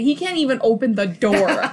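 ordinary delivery

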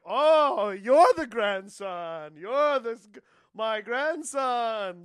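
loud, long-drawn proud exclamation; delighted grandpa. Retold or imitated. Grandpa praising grandson the next day, delighted. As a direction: stretch each word in imitated, hammed-up joy. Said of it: "He's like".

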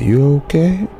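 a light voicing of the rescuer; old man checking on me. Old man helping narrator up after the shooting, lightly voiced. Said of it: "helped me up to my feet and said".

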